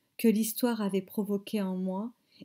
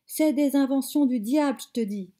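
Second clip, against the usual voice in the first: higher and louder on the first words, dropping after; grandmother yelling; 5 semitones higher, 6 dB louder than usual. A narrator's reading. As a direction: grandmother shouting that it's the devil's work. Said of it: "hurla-t-elle".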